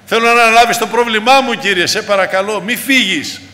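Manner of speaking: loud, high-pitched pulpit pleading; fervent preaching. Acted. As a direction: build a fervent appeal, phrase after phrase. Preacher pleads at full force.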